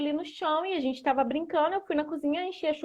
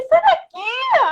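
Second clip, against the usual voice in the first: sharp, very high, loud slow yell of "Sai daqui"; shouting at the cat; 9 dB louder, much slower than usual. Retold or imitated. Shooing a hurt cat off the sofa, loud shout. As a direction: drive the cat off with a piercing yell.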